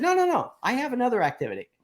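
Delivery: high, fast no no no; quick emphatic refusal. Retold or imitated. To her client about rescheduling, a high, quick protest. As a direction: brush the idea off in a rush.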